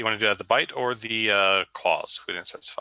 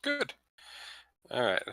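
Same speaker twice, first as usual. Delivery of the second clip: ordinary talk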